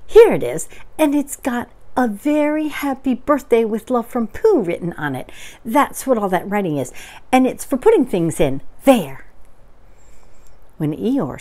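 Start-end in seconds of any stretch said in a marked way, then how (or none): 0.1-9.2 lively, emphatic show-and-tell; excited Pooh. Acted. Pooh explains his gift to Eeyore, lively and excited. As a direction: present it eagerly, punching the first and last words.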